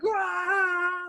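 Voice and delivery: very high, loud war scream; blood-curdling scream. Retold or imitated. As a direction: scream to scare off a predator. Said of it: "screaming at this thing".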